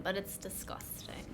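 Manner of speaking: whispered disgust. Real, not acted. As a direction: breathe it out grossed out.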